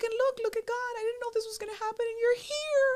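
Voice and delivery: very high, tearful gushing; imitated bawling. Fan thanking God on her birthday, bawling. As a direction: mimic a fan overcome, words tumbling out in a squeaky cry. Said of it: "she just starts bawling".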